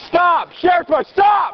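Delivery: high, frantic repeated yelling; shouting stop. Real, not acted. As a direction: scream the command over and over. Officer yelling at suspect to stop.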